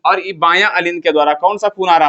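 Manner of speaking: usual speaking voice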